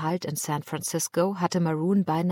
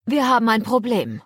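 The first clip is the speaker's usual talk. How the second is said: raised voice, pitched higher and louder to carry over a storm; calling over the wind; 5 semitones higher, 6 dB louder than usual. Acted. Sailor calling to the captain over the roaring wind, loud. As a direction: shout it across the deck. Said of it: "rief der Matrose über das Tosen des Windes hinweg".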